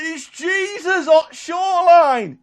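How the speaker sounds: high, loud, drawn-out holler; re-enacted long-range shout; excited shout. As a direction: bellow the news across the water. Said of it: "someone shouts".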